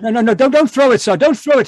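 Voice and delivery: urgent pleading shout. Acted. Watson shouting at the colonel not to throw the spear. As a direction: beg him loudly to stop.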